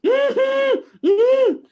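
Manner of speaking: put-on a sheepish kid whimpering yes with his mouth full; whimpered yes. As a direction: nod it out in scared little whimpers. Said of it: "And he's like".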